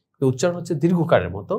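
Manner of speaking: normal talking voice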